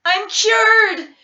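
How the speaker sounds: loud, high "I'm cured!"; shouted. A narrator's reading. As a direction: burst out with joy. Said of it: "she shouted".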